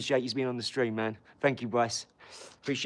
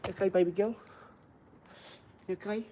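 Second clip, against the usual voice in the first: tender pet talk; 7 semitones higher, much faster than usual. Real, not acted. Owner checking on baby girl the dog, tender high voice. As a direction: coo it gently upward.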